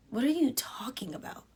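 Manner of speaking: a soft breathy what are you talking about; hushed, baffled under the breath; whispered confusion. Real, not acted. To the chat, whispering in confusion. Puzzled reply to viewer question.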